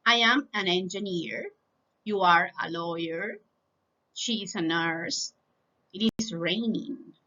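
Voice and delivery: each word laid out slowly for the class to echo; slow, clear model sentences. Shown as a demonstration. Teacher to Spanish-speaking class, slow careful English model sentences. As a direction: say it slowly so students can repeat. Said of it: "repitan después de mí".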